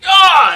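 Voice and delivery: sudden very high, loud cry of pain; loud high yelp of pain; pained shriek. Acted. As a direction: shriek as if something bit you. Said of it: "he's like".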